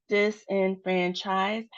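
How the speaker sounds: slow "disenfranchise", evenly spaced syllables; separated syllables. Shown as a demonstration. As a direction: spell out the beats of "disenfranchise" by ear. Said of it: "has four".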